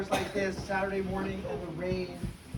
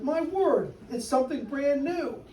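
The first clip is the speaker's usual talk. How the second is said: amazed; 6 semitones higher than usual. Acted. Crowd amazed.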